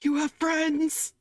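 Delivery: high, slow, disbelieving dwarf; a barkeep who can't believe it; incredulous dwarf barkeep. Acted. [name] incredulous about Malachi.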